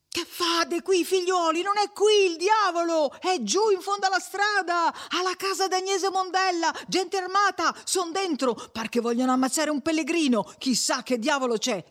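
high, urgent alarm shout; frantic high-pitched cry, easing at the end. A narrator's reading. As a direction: yell the news to the whole crowd. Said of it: "Quand'ebbe ripreso fiato, gridò".

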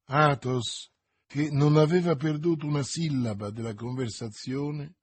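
usual speaking voice

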